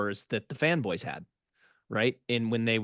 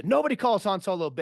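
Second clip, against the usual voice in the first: angry; 8 semitones higher, 4 dB louder, faster than usual. Retold or imitated. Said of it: "they start fighting".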